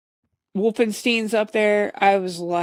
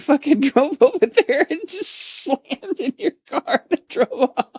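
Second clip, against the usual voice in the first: higher, laughing through the words; 8 semitones higher than usual. Real, not acted. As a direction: giggle your way through it. Confessing [name] hit car.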